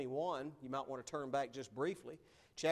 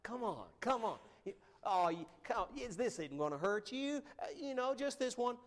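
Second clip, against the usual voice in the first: high-pitched coaxing of a bad crowd; mock persuasion, climbing higher toward the end; peer-pressure tempters; 7 semitones higher than usual. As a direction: wheedle like friends talking someone into it. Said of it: "they begin to say things to you like".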